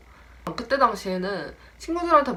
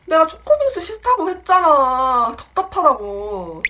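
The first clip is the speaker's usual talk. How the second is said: louder, higher, slowly drawn out complaint; imitating a huffy outburst; 6 semitones higher, 6 dB louder, slower than usual. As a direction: act out the friend snapping in annoyance, stretched slow. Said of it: "승질을 내는 거예요".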